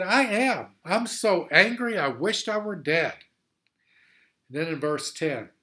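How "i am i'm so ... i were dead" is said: loud, high angry insistence; hammed-up tantrum; Jonah furious. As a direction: shout it stubbornly. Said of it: "he said".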